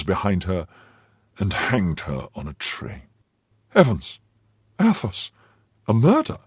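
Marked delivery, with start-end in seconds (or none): 3.7-6.4 shocked outcry, rising sharply on Athos; stunned friend crying out in horror; horrified cry. A narrator's reading. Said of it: "cried D'Artagnan".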